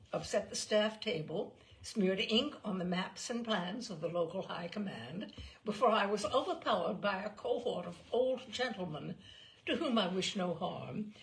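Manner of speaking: ordinary delivery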